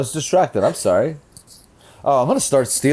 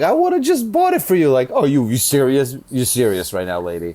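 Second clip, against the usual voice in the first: mocking moms. Mocking moms who claim they'd have paid. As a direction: parody the mom, then answer her. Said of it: "I hate when moms are like".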